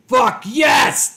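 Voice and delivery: a loud, gleeful yell of approval; excited shout. Real, not acted. Answering a viewer about whiskey sours, loud excited shout. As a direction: blurt it out like it's the best question ever.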